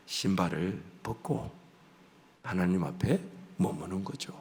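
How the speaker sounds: gentle low invitation; soft invitation. Real, not acted. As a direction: draw the listeners into stillness. Soft inviting sermon close.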